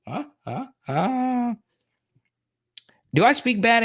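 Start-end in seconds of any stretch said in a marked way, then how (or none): none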